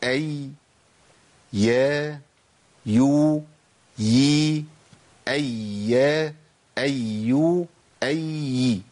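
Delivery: each syllable أي يا يو يي said clearly and apart; drill of the ya sounds. Shown as a demonstration. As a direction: sound out أي، يا، يو، يي one by one for the class. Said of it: "تاني وركزوا معايا". (نطق أي، يا، يو، يي ببطء ووضوح)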